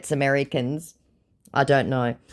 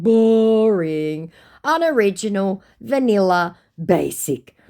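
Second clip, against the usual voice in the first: high, drawn-out, unimpressed list; bored drawl; 7 semitones higher, 5 dB louder, slower than usual. Retold or imitated. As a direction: drag out each word, bored. Listing insults, drawn out.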